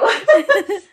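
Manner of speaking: bright high burst of laughter. Real, not acted. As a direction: laugh up high at own joke. Laughs after her joke.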